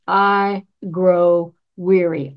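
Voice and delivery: slow weary admission. Acted.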